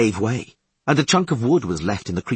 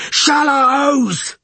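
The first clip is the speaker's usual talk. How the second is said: very high, loud, drawn-out call; warning cry; 7 dB louder than usual. A narrator's reading. Leadsman warning crew of shallows, drawn-out high yell. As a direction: cry out the danger to all.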